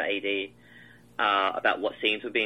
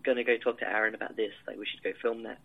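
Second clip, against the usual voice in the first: hushed, much softer mock whisper; retold whisper; 7 dB quieter than usual. Director to Max over the radio mic, retold whisper. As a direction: quietly pass word to a colleague on mic. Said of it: "I could just whisper".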